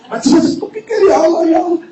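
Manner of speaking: high-pitched laughing despair; mock panic. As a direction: squeak it out, overwhelmed. Panicked thoughts, half laughing. (panik pura-pura, suara tinggi sambil tertawa)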